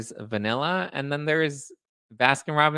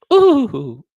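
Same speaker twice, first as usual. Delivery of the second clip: high loud mocking ooh; a taunting ooh; 12 semitones higher, 12 dB louder than usual. Real, not acted. Taunting haters with a high loud mocking ooh.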